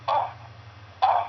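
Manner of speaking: mock moaning in a smoker's voice; hammed-up hoarse "oh"; imitated raspy moans. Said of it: "all she could hear was".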